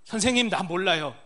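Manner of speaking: desperate cry. Pretend. Deaf patient's inner cry.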